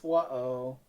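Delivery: ordinary talk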